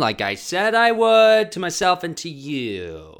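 loud, high, slow proclamation; big theatrical opening vow; grand declaration. Acted. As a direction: belt it out like a triumphant announcement.

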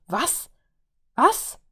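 stunned disbelief, repeating what; outraged what? what?; baffled double take. Acted. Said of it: "stutzte der Bogenschütze".

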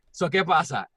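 normal talking voice

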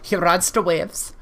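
put-on German accent, a little halting; vaguely German accent. Podcaster playing a German audio surfer, vaguely German accent. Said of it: "vaguely German".

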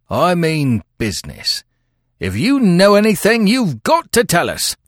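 forceful earnest warning. A narrator's reading. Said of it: "said the detective earnestly".

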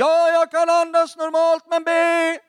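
imitating a man yelling back; high, strained shout across a room; background scream. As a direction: yell it impatiently from the background. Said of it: "Han skrek i bakgrunden".

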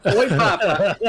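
laughing mock call.

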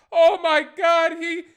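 high, loud, gushing exclamation; hammed-up rapturous outburst; mock ecstatic amazement. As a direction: cry out as if overwhelmed. Imagined grateful reaction to inventor.

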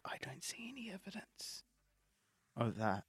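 unvoiced doubtful whisper. Real, not acted. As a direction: whisper it skeptically. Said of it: "And I'm just like".

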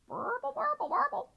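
very high, bubbly bird warble; burbling demonstration. Acted. Cob shows Louis how to burble, high warbling voice. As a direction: trill it like a burbling swan. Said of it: "Like this".

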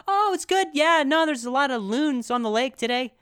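high, strained-casual chatter; nervous falsetto-like small talk; different register. Retold or imitated. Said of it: "my voice is in a different register".

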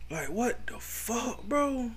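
high, slow disbelief; incredulous. Real, not acted. Host outraged about the baby, high and slow. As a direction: stretch it in outrage.

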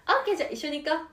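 high, fast, bright-up invite; switched-on cheerful. Pretend. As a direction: flip the switch to upbeat mom. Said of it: "スイッチあげて".

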